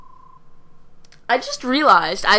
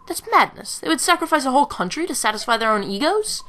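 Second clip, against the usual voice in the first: higher, incredulous character voice; indignant, pitched up; outraged disbelief; 6 semitones higher than usual. Acted. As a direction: protest in acted disbelief. Character outraged at nobles' whims.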